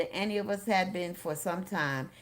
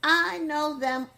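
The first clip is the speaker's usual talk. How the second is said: put-on high, loud, drawn-out girlish cry; slow, loud burst of excitement; excited cry; 9 semitones higher, 11 dB louder, slower than usual. A narrator's reading. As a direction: cry it out like a thrilled teenage fan. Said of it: "she cried excitedly".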